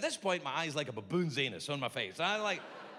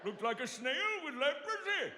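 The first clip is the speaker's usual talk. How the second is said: laughing; 11 semitones higher, slower than usual. Retold or imitated. Repeats doctor's line laughing.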